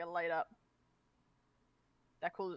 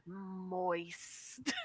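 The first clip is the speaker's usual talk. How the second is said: low, drawn-out word with a lingering hiss; creepy teasing; 4 semitones lower, 7 dB quieter than usual. Retold or imitated. Teasing friends who dislike the word, creepy and drawn-out. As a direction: draw it out quietly to make them squirm. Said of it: "just come up to them and just go".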